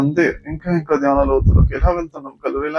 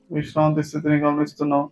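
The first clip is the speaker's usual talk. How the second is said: normal talking voice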